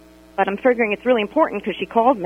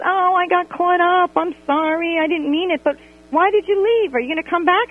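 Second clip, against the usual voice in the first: a hint of a higher, pleading friend; mock whiny apology; 9 semitones higher than usual. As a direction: whine your excuse a little. Mimicking apologetic whiny Mindy.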